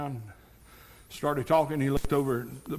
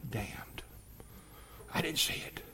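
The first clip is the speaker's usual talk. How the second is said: whisper; 2 semitones higher, 10 dB quieter than usual. Acted. Preacher reading the verse on the damned, whispered. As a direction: drop to a whisper for the damning verse.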